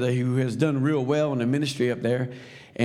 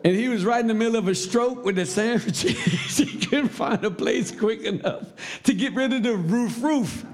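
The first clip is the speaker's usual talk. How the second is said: high-pitched, laughing through the words; real laughter; 9 semitones higher than usual. Real, not acted. Storyteller delivers the sandwich punchline, laughing as he talks. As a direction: crack up while telling the punchline. Breaks up at his own punchline.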